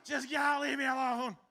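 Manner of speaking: high, loud get-out yell; loud, exasperated shout. Retold or imitated. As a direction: shoo the pets away in a panic. Yelling at pets from toilet.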